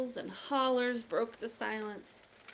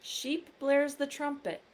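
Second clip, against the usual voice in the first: ordinary delivery, nothing marked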